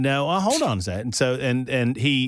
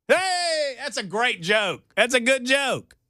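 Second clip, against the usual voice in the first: self-congratulating; 11 semitones higher, slower than usual. Acted. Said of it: "praising own joke".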